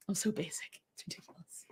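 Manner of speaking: whispered, embarrassed aside. Real, not acted. Admitting to viewers she's basic, in a whisper. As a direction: breathe it out, hushed.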